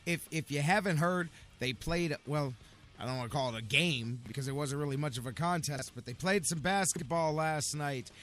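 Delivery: usual speaking voice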